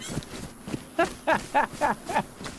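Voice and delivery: airy wheezing laugh; real silent wheezy laugh; breathless laughter. Real, not acted. Laughs at own quote.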